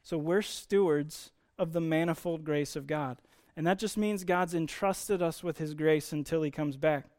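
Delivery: usual speaking voice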